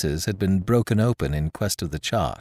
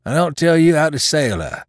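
higher, louder seaman's voice driving a bargain; wily pirate sailor; 4 semitones higher, 5 dB louder than usual. Acted. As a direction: bargain like a wounded mutineer, in sailor's dialect.

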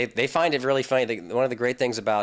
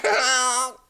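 high cartoon giggle; 6 dB louder than usual. Laughs in character voice.

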